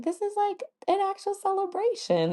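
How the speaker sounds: high, giddy quoted gush; gushing delight. Retold or imitated. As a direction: squeal it like a delighted kid. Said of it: "I was like".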